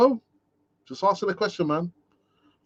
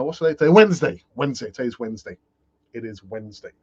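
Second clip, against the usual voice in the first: ordinary talk, nothing marked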